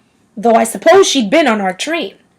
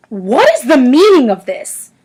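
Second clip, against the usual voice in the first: a sharp, stern demand, a touch louder; stern outburst; 2 semitones higher, 4 dB louder than usual. A narrator's reading. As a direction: make the children jump with a stern question. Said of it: "in a voice that made some of the little girls jump".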